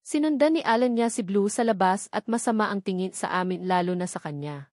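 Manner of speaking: ordinary delivery, nothing marked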